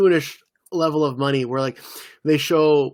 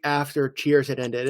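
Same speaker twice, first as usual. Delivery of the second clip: usual speaking voice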